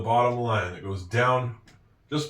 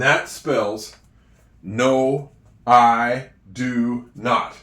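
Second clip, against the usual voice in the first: And that spells no, I do not, said very slowly; teacher slowly reading the finished sentence aloud; slow, deliberate read-out; 5 dB louder, much slower than usual. Shown as a demonstration. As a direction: read it back word by word. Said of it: "reading back the sentence".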